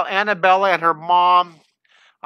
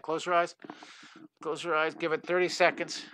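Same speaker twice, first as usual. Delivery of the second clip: soft instructions; 9 dB quieter than usual. Real, not acted. Giving husband his eye drops.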